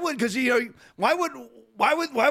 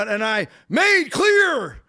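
slow, halting, louder mock Biden; mock Biden speech fart; 4 dB louder, much slower than usual. Mocking the president's stumbling delivery, slow and louder. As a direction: stretch it out like a stalled old speaker. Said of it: "has speech farts right in the middle of his speeches".

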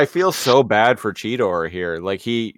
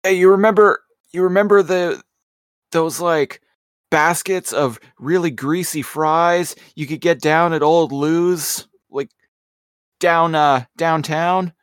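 hopeful soldier comforting friend; 7 semitones higher than usual. Pretend. Playing war-movie soldier comforting buddy.

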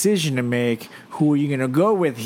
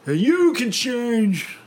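speechmaker voice; 4 semitones higher, 3 dB louder than usual. Retold or imitated. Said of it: "It's like".

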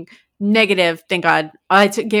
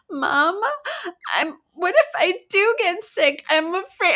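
put-on small, high little-girl voice; imitated worried child; 9 semitones higher than usual. Daughter calls mom at bedtime, imitated worried child. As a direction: mimic a fretful daughter, pitched high. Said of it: "She's like".

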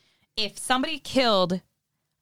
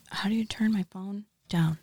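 whisper; 4 dB quieter than usual. Real, not acted. Phone noise during ASMR.

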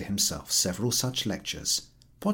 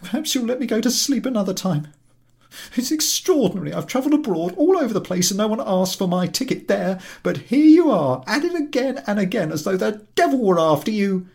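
high, loud indignant rant; ranting invalid; 13 semitones higher, 8 dB louder, faster than usual. Acted. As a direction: berate the collector.

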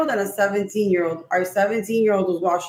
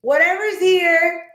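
stern to the spirit; 8 semitones higher than usual. Retold or imitated. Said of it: "And I was like".

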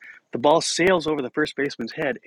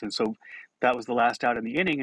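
plain reading voice

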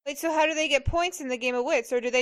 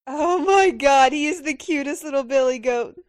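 excited gushing; 8 dB louder than usual. Real, not acted. Gushing over cute goat.

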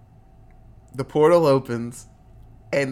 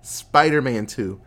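ordinary talk

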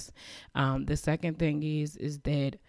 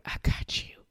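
God's reassurance. Retold or imitated. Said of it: "he has a way of being like".

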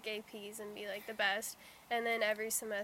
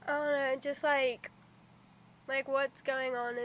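ordinary delivery

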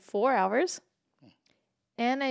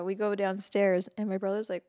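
ordinary delivery

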